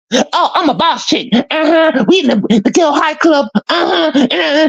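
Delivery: very high-pitched sassy girl imitation; mock boss chick. Man mocking Kiki as boss of the girl club, sassy falsetto. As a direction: put on a squeaky, self-satisfied girly voice.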